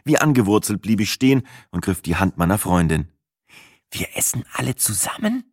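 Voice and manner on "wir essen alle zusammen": ground out through teeth. A narrator's reading. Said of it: "knirschte ich Sina mit schiefem Gesicht zu".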